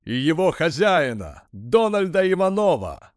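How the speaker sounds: high rising announcer call; ringmaster. A narrator's reading. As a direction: present the owner with flourish. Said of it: "раздался голос из динамиков".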